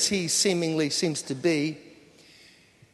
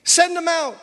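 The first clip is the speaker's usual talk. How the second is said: mock mob yell; 9 semitones higher, 4 dB louder than usual. Men of Sodom surrounding the house, mock yelled demand. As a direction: call it out high, like a demanding mob. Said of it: "start yelling out".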